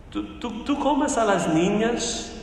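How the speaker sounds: put-on a touch of a nervous little girl; timid girl. A narrator's reading. Frightened girl questions the lion.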